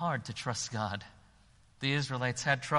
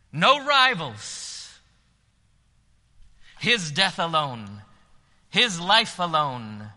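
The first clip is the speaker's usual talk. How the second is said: preaching at full force; 5 semitones higher, 10 dB louder than usual. Acted. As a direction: lift the whole room with the invitation.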